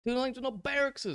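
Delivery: high-pitched mimicry of a heated teammate; high, insistent parody of an arguer; imitating aggressive tone. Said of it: "he's speaking in a very aggressive manner".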